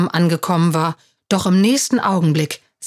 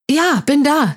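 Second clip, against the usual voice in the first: higher-pitched quick answer; calling out; 8 semitones higher than usual. A narrator's reading. Jonas answers the teacher's roll call, calling out.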